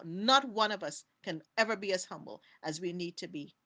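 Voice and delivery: usual speaking voice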